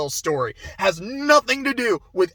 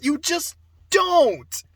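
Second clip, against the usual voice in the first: usual speaking voice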